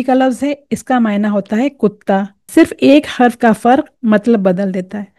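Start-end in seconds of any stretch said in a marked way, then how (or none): none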